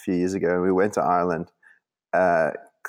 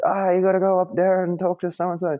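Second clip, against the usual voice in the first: high-pitched, badly faked Irish accent; amateur Irish lilt, pitched well up; 10 semitones higher than usual. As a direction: imitate an Irish local with a shaky grasp of the accent. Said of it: "I can't do the Irish accent".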